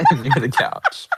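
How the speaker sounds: amused, breaking into real laughter; laughing. Real, not acted. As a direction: say it through laughter, high. Both laughing at the joke.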